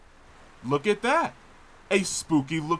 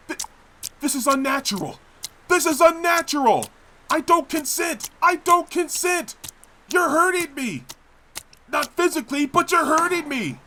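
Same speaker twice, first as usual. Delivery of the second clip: higher and louder, panic building line by line; rising panic; 7 semitones higher, 3 dB louder than usual. Acted. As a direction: protest in mounting alarm as your body betrays you. Body forced against will, panicking.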